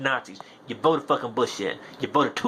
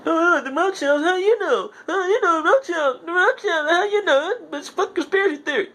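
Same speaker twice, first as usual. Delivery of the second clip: mocking voice; 5 dB louder than usual. Mocking conspiracy-theory deniers.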